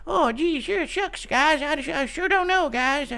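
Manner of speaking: very high, meek cartoon boy; aw-shucks falsetto child; Butters. Shown as a demonstration. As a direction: hesitate in a tiny high-pitched kid voice. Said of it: "Butters is a".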